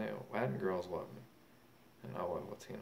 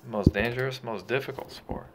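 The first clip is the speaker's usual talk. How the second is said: higher and louder, hammering each point; loud heated rant; 5 semitones higher, 10 dB louder than usual. Real, not acted. Boxer loudly defends his sport against critics, heated shout. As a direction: lecture the doubters at the top of your voice.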